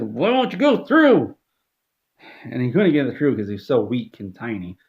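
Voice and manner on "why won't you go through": high, loud, slow frustrated shout; high hammed-up rage; mock rage. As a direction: scream it at the lane. Smashing ball into lane.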